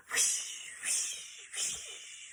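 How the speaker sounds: whispered whoosh fading away. Acted. Sisters whizz down the mountain, whispered fading whooshes. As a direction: breathe the whoosh as they zoom off. Scooter zooming sound effect.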